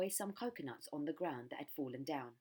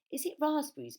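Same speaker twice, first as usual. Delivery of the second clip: high and louder, hopeful; eager guess; 11 semitones higher, 9 dB louder than usual. Acted. Bird hopefully guesses raspberries, high and eager.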